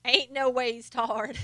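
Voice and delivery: slow Southern drawl; lightly put-on Southern twang, stretched out; mock Southern accent. Quoting a faked Southern line.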